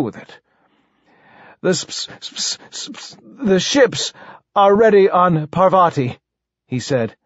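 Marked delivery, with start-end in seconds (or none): 1.6-6.2 higher, halting royal voice; stuttering king. Acted. King reports the ships are ready, stammering. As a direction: stammer on hissing sounds, then push through.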